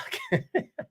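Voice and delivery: a sudden squeal of a laugh; high squeaky giggle. Real, not acted. Host giggling at his own joke, high squeal. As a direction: burst into a tiny high giggle.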